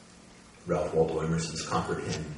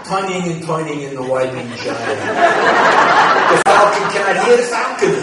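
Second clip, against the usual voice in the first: much higher and louder Queens accent, hammed up; loud comic Queens accent impression; Jimmy Breslin's Queens accent; 11 semitones higher, 17 dB louder than usual. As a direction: ham up a New York Queens accent reading Yeats. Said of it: "in his Queen's accent".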